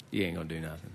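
a low muttered dare, teasing; low taunting voice. Retold or imitated. Big man dares him he won't jump, low and soft.

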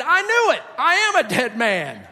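high, loud burst, falling away; mock-despairing yell, dragging out the last words; Isaiah's imagined outcry. Preacher imagining Isaiah burned by coal, hammed-up despairing cry. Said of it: "and I said".

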